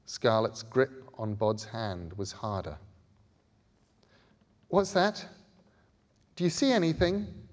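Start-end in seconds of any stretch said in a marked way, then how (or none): 4.7-7.5 high, urgent whisper of fear; frightened girl. A narrator's reading. Scarlet frightened in the dark chamber, asking fearfully. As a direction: ask it scared and close. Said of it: "Scarlet made a noise that was half gasp".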